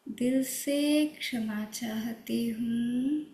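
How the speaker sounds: very slow, tender apology; said with love. Shown as a demonstration. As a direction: speak each word lovingly, slowly. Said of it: "और बहुत प्यार से". (बहुत प्यार से धीमे-धीमे क्षमा माँगना)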